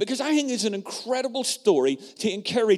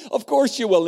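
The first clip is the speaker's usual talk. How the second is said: playful insistence; 4 semitones higher, 6 dB louder than usual. Retold or imitated. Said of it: "she answers for them and says".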